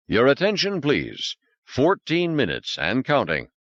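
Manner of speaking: high, measured computer voice; automated countdown voice; intercom announcement. Acted. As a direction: announce it like a station PA.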